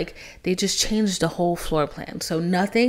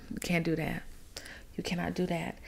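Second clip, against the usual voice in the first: whispered warning; 11 dB quieter than usual. Acted. Warning against removing the loft.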